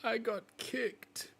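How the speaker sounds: high, slow, whimpering plea for help; pleading hurt wolf. Acted. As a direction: beg like a wounded young wolf. Wolf replies.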